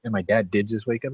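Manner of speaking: ordinary talk, nothing marked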